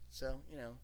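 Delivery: usual speaking voice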